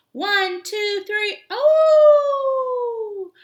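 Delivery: high, loud countdown ending in a long held yell; race countdown shout. Acted. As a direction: count them off and launch the race with a cry. Coyote starts the race.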